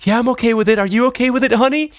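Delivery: high-pitched wife voice. Acted. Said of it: "And the wife goes".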